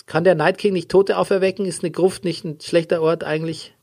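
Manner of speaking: ordinary talk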